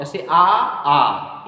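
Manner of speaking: आ held long and slow, like a crow's caw; long vowel aa. Shown as a demonstration. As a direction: stretch each आ into a long vowel. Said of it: "उतनी देर में दीर्घ स्वर बोला जाए".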